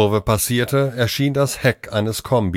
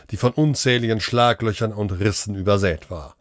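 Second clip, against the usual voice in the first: plain reading voice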